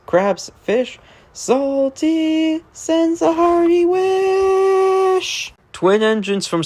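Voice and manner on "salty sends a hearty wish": high, very slow hearty sea-dog voice; drawn-out sailor call. Acted. Salty sending his wish to listeners, high and slow. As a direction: stretch it out like a sailor hailing the dock.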